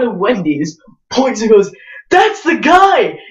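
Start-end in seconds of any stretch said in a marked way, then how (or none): 2.1-3.2 retold shout. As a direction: point and cry out to the street. Stranger points and calls out.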